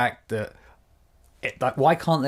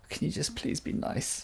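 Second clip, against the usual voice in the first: breathy pleading whisper, higher and much softer; pleading half-whisper; 5 semitones higher, 9 dB quieter than usual. Retold or imitated. Shop worker silently begging a rude customer, whispered plea. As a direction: beg under your breath for some kindness. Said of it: "And you're like".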